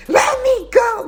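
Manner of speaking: furious cry to be let go; angry shout. Retold or imitated. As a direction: yell it high and loud at full force. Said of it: "and I was so mad. I'm like".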